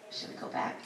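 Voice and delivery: whispered conspiratorial question; sneaky whispered suggestion. Retold or imitated. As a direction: hush it like a secret plan. Conspiring to return.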